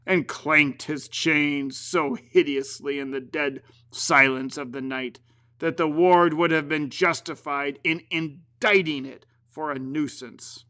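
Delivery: plain reading voice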